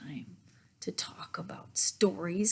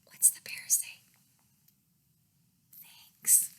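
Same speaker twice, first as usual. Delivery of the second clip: whispered prompt; 12 dB quieter than usual. Shown as a demonstration. Prompting the child to answer.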